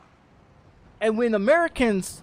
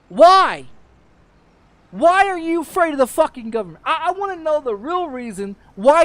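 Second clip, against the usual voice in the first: high, loud, furious questioning; shouting at viewers, demanding what they fear; 7 semitones higher, 4 dB louder than usual. Real, not acted. Yelling it at people, higher and louder each time. Ranting at viewers about fearing government.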